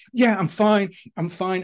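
imitating a runner who won't admit he's struggling; mock runner insisting fine. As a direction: pitch it up, fake-casual "I'm fine". Runners pretending they are fine.

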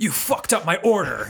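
mock angry customer. To the podcast co-host, mocking an angry customer complaint. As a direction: play the rude, indignant customer. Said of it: "It's never like".

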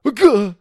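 high loud yelp of surprise; startled yelp. Acted. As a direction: yelp as you trip. Trips on gum.

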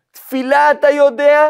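can't believe he doesn't know; incredulous question. Acted. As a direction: rabbi asking in disbelief. Rabbi questions the ignorant student.

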